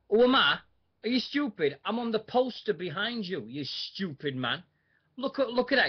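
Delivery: a touch of heel wrestler scorn, pitched high; Styx's retort. Retold or imitated. Wrestler snapping at a heckler, high mocking scorn. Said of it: "he just turned around and said".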